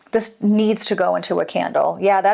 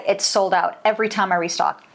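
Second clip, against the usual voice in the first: ordinary talk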